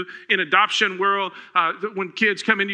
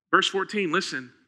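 normal talking voice

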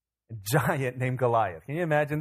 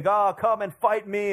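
mock challenge call; 6 semitones higher, 3 dB louder, slower than usual. Goliath calling out challenge.

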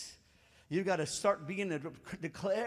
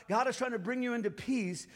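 usual speaking voice

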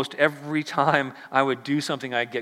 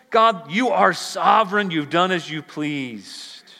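higher and louder desperate prayer; pleading sailors' cry; 6 semitones higher, 6 dB louder than usual. Acted. Sailors crying out to God in the storm, pleading. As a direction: beg God for your life.

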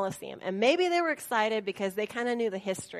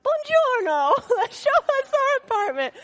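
much higher, laughing sing-song; mock cheerful; 14 semitones higher, 6 dB louder than usual. Greeting the neighbor, high laughing mock cheer. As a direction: gush it through laughter.

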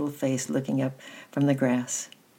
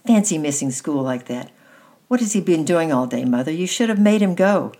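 loud, higher scolding, building to the end; exclaimed reproach; 3 semitones higher, 7 dB louder than usual. A narrator's reading. Sister berating her brother for missing school, indignant exclaiming. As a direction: scold indignantly, rising in pitch and volume. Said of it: "she exclaimed as she looked at him".

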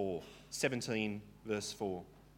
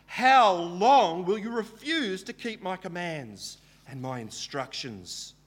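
slow, louder, higher rebuke from God; the Lord rebuking; 5 semitones higher, 7 dB louder, slower than usual. Acted. Preacher voicing God rebuking Moses, slow and stern. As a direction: ask it slowly like a stern, weary God.

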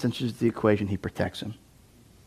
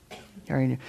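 softer repeat; 8 dB quieter than usual. Shown as a demonstration.

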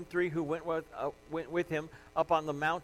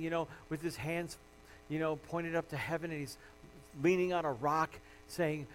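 ordinary talk, nothing marked

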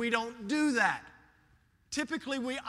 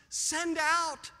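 higher, drawn-out appeal; pleading with God; 5 semitones higher than usual. Acted. Psalmist pleading with God, raised voice. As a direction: call upward in earnest petition. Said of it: "Notice he pleads with God".

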